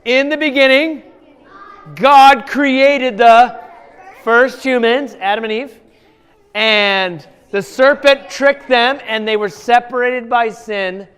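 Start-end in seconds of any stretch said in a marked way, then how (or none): 0.1-8.3 slow, louder storyteller start; slow teacher model of the opening line; model retelling. Shown as a demonstration. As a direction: lay out each word slowly for them to copy. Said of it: "I want you to practice".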